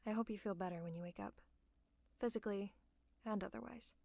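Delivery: hushed, soothing bedside voice; gentle bedside concern. Acted. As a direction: talk gently to a sick friend. Tending to feverish, sleepy hero.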